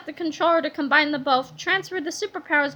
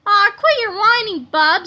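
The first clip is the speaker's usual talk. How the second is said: louder dismissive scold; 5 semitones higher, 7 dB louder than usual. A narrator's reading. George scolding Melvin, loud and dismissive. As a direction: brush off his whining. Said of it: "said George".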